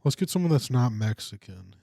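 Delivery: normal talking voice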